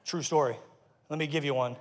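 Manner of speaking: usual speaking voice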